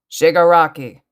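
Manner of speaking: loud, forceful call of a name; yelling. A narrator's reading. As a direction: yell it as a furious challenge. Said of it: "he yelled".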